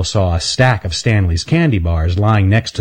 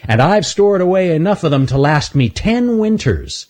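higher smug chipmunk voice; boastful chipmunk; 9 semitones higher than usual. Acted. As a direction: brag about the hoard. Earl boasting.

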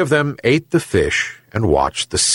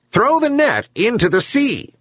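high-pitched herring gull voice; talking gull; 12 semitones higher than usual. A narrator's reading. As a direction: call out instructions as a seabird. Gull speaks to the old man.

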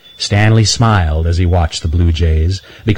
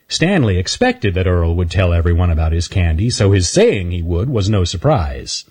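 ordinary delivery, nothing marked

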